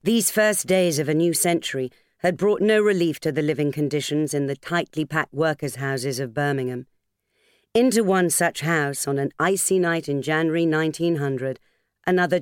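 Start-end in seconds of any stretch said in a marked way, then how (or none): none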